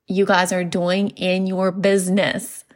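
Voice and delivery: usual speaking voice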